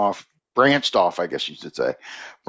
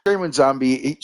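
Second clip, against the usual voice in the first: ordinary talk, nothing marked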